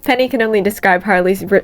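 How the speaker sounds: ordinary delivery, nothing marked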